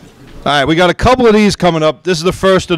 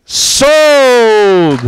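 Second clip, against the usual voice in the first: emphatic sold call; 7 semitones higher, 4 dB louder than usual. Real, not acted. Auction hammer call.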